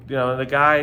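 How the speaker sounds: ordinary delivery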